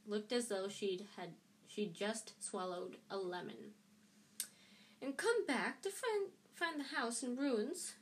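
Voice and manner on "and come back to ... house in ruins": high, biting snarl, slowing down. A narrator's reading. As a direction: snarl it with contempt. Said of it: "she snarled".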